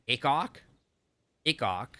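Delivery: TikTok with the T's left out, "Ikok"; T's dropped. Shown as a demonstration. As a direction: leave off both T's, saying Ikok. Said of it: "how do you say it with a less hard T?".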